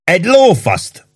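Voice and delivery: slow, high indignant refusal; affronted high-pitched exclamation. A narrator's reading. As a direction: draw out a scornful, huffy no. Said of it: "mondta méltatlankodva a szakács".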